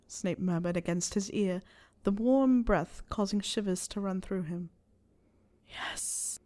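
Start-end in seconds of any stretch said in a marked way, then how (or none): 5.7-6.4 unvoiced, breathy hiss; hissed yes. A narrator's reading. Harry urging Snape on, hissed whisper. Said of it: "Harry hissed out".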